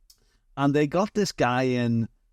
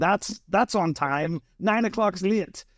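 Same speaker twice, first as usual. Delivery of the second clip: retold boss, brash New York accent, pitched higher; 8 semitones higher, slower than usual. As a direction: do the angry New Yorker's thick accent, raised pitch. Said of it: "thick New York accent".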